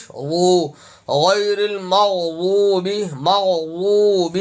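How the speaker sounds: المغضوب drawn out with ض; slow correct recitation. Shown as a demonstration. Teacher models مغضوب again for practice. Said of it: "ان کو ٹھیک کریں".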